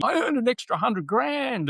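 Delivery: bragging about a windfall; proud client. Retold or imitated. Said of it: "like people going".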